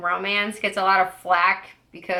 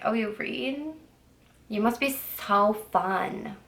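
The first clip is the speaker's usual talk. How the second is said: mocking tone; 6 dB quieter, slower than usual. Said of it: "they were like".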